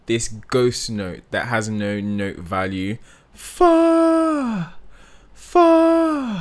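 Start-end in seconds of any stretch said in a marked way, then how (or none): 3.3-6.4 "For" sung high and loud, trailing off in air; breathy release. Shown as a demonstration. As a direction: let "For" fall off into breath. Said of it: "throwing it off with air".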